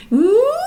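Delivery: high gliding hum; humming siren. Shown as a demonstration. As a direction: hum a glide high in your range. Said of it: "That's one of them".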